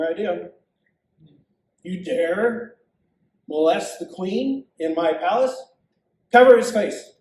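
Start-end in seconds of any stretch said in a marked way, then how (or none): 1.8-7.1 building to a loud roar; furious king. Acted. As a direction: thunder the command. King catches Haman with queen.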